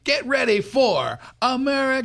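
high hammed-up announcer singing a fanfare; mock promo fanfare. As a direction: sing da da da like a cheesy show intro.

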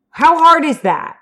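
high, slow, incredulous outburst; fed-up rhetorical question; exasperated demand. Real, not acted. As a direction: demand it as if it were obvious. Ranting at the characters.

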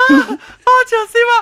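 very high, squealed through laughter; dying of laughter. Real, not acted. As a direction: gasp it out while cracking up. (笑甲欲死)

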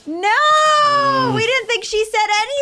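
high loud cry of disbelief; shocked shout. Real, not acted. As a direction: blurt it out in shock. Shocked at the quiz answer.

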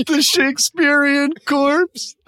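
high, slow hammy declaiming; theatrical voice. Acted. Friend joking about the corpse, high and hammy. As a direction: intone it like a ham actor. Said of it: "laughing theatrical voice".

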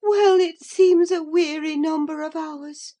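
high, loud doubtful musing; weary disbelief in a high voice. A narrator's reading. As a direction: question it aloud, pitched well up. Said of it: "she muttered doubtfully".

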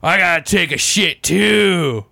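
slow, lightly hammed-up drunk voice; drunk dude. Retelling a drunk guy announcing himself, slow mock drunk. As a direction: drawl it like a chatty drunk in the next stall. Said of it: "He's just like".

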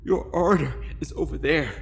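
high, shaky, pleading voice. Acted. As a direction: beg her with a trembling voice. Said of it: "my shaky voice pleaded".

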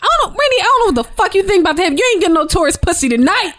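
high, fast, excited scolding; mock telling off. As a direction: scold him, half laughing, pitch way up.

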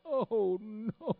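high, loud acted dread; mock dismay. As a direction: cry it out as if cornered by a prophecy.

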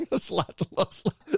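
laughing. Real, not acted. Cracking up at the joke.

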